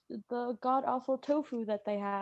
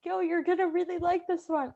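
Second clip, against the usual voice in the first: excited cafeteria staff; 6 semitones higher than usual. Retold or imitated. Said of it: "they'd be like".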